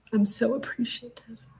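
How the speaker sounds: breathless whisper, voice almost gone. Real, not acted. Streamer overwhelmed by gifts to her viewers, in a whisper. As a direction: whisper it, too moved to speak up.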